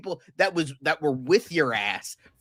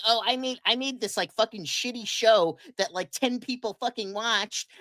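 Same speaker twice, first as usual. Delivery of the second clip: higher, sneering impression of a smug actor; mocking imitation; 6 semitones higher than usual. Host mocks actor's smug pride, higher sneering parody. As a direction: parody him as a self-important snob.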